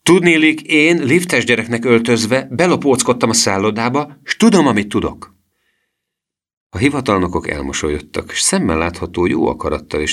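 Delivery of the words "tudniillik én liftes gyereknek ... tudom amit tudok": high, loud explanation; pitched up and emphatic; boy calling out. A narrator's reading. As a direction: announce it triumphantly in a raised voice. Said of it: "kiáltotta Gusztáv".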